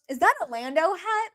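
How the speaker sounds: high, mocking imitation of a guy; high rising mock question; mocked chat-up line. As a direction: mimic his lame opener, pitched high. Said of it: "what a lame ass way".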